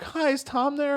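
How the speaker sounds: put-on high, polite old lady voice; high pitched grandma on the phone; elderly woman. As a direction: ring up sweetly as a little old lady. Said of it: "elderly woman... she was like".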